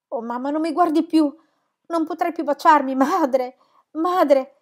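grieving lament. Acted. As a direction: wail over her body, in despair. Lament over murdered mother.

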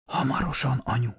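soft, hushed voice; whisper. A narrator's reading. Dying daughter answering her mother on the terrace, whispered. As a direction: whisper it gently, well under speaking level.

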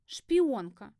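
"шпионка" pushed out as an admission; reluctant confession. A narrator's reading. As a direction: blurt out "шпионка" after hesitating. Roommate blurts the accusation.

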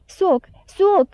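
narrated wail, pitch jumping up; a touch of a child's tearful pleading; mild tearful cry. A narrator's reading. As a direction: call out high, on the edge of crying. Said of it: "începu el să plângă".

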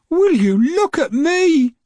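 grandma thrilled as she takes off; delighted cry. Acted. Grandma flying, calling to James.

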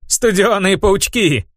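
high, slow, delighted cry; excited satyr. A narrator's reading. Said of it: "сказал он".

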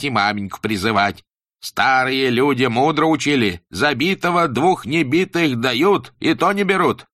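high, slow, solemn schoolmaster; stern teacher voice. Acted. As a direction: preach it like a strict old teacher. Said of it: "ударяя, приговаривал".

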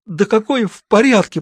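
high, moaning complaint; burned sister moaning at the doctor. A narrator's reading. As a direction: groan it out in pain. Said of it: "простонала Маша".

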